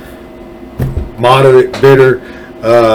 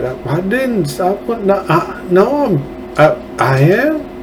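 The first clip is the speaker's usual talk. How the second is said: confused Breyer; 3 semitones higher, 7 dB quieter than usual. Retold or imitated. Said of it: "it's like".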